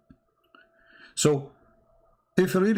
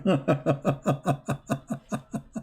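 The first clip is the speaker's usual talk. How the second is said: long chuckle trailing away; self-amused laugh; 4 semitones higher than usual. Real, not acted. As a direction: laugh at yourself in quick bursts, fading out. Laughing at own funny English.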